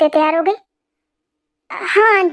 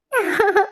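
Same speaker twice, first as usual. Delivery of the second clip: giggle; 4 semitones higher than usual. Acted. Jimin can't stop laughing.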